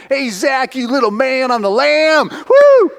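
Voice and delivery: high, loud excited whoop; rising to a loud, high whoop; excited shout. Retold or imitated. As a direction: cheer him like a buddy on the run. Tyler excited to see Zach.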